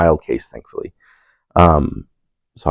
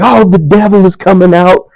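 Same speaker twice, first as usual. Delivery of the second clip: mock preacher exclaims; 14 semitones higher, 8 dB louder than usual. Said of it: "he's like".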